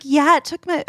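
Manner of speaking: put-on half-hearted British accent; attempted British accent. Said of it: "I can't do a British accent".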